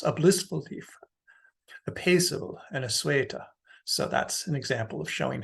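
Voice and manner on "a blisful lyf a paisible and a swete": "blissful", "paisible", "sueta" with their stressed syllables brought out. Shown as a demonstration. Lecturer gives an example line, stressed syllables marked in "blissful", "paisible", "sueta". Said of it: "capitalized the stressed syllables".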